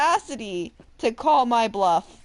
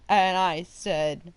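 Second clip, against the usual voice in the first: normal talking voice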